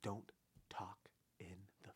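deliberate, barely audible admonition; hushed warning. Pretend. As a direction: whisper it one word at a time. Gives movie-date tip in hush.

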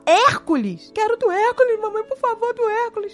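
put-on high little-kid plea for Hércules; imitating child begging. Mother imitating her small son begging for Hércules, high pleading child voice. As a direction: beg mommy like a small child.

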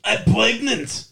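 put-on a slow, punchy Austrian accent; Arnold Schwarzenegger impression. Quoting the movie in a slow Austrian accent. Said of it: "Arnold Schwarzenegger".

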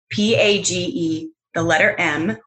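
P A G E, the letter M said letter by letter; spelling it out. Shown as a demonstration. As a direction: spell P A G E out, each letter apart. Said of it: "your first name is spelled?".

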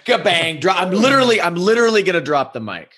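high, hyped mock announcement; joking to the guest that the episode is over, high and hyped; playful mic drop. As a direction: play up the big finish like a showman. Joking about ending the episode.

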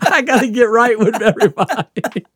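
high, loud laughter through the line; laughing. Real, not acted. Explaining the shots to his friend, laughing. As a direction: giggle it out.

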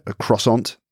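croissant with hard English CR and sounded T; croissant as "cross-ont"; anglicised croissant. Shown as a demonstration. Saying it for a British person, anglicised pronunciation. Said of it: "and to a British person?".

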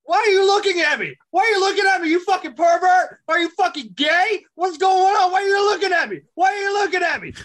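screaming ghost. Pretend. Ghost screaming at the man who sees him, high shriek. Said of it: "I'm like".

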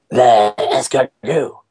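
slow, badly faked French accent; attempted French accent. Quoting nine-year-old Alden at dinner, attempted French accent. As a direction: try hard at a French accent and miss. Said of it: "trying to say it with a really good French accent".

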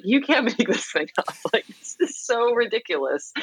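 high, laughing 'you can't make this up'; laughing disbelief. Retold or imitated. Recalling a shocking video, laughing in disbelief. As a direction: giggle through your own shocked reaction.

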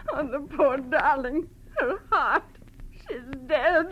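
crying through the words; sobbing. Acted. Best friend sobbing that Lola is dead. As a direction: grieve openly, voice breaking.

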